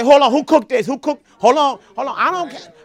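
loud, high, suspicious questioning; suspicious eater. Pretend. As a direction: demand to know who cooked it. Mimics a picky eater at a meal.

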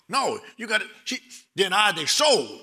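ordinary talk, nothing marked

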